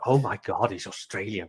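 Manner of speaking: breathless whisper, mock-swooning. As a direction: whisper it like a smitten fan. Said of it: "It's like".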